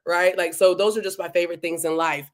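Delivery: ordinary talk, nothing marked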